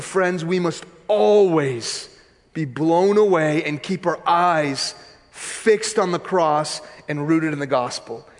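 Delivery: normal talking voice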